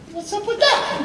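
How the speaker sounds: very high, kid-like mock voice; boy asking about his old parents; kid's question. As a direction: play the puzzled eight-year-old. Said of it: "So I'm like".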